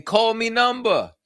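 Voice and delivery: mock Jamaican psychic hotline pitch, high and drawn out; high, stretched-out mock Jamaican accent; Miss Cleo. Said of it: "It's not Miss Cleo".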